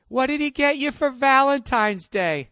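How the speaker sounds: put-on high, loud gossiping girlfriend; nosy girlfriend. Quoting the ad copy, he voices a nosy friend asking about Valentine's gifts in a high, loud voice. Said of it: "that line that all women say".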